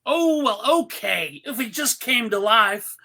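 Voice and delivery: ordinary talk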